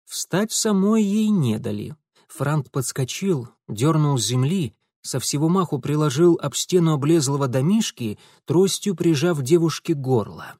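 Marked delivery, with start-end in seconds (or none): none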